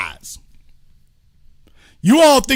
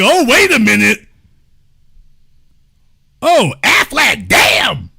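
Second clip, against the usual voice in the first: louder, alarmed cartoon duck voice; Aflac duck; 4 dB louder than usual. The insurance duck spotting the fallen workers, louder and alarmed. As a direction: squawk it out like the duck just saw the crash. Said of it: "the Aflac duck saw them and was like".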